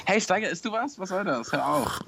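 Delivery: high-pitched, hammed-up telling-off; play-scold yourself like a fussy host; mock self-scolding. Host pretends to scold himself for eating, high mock telling-off.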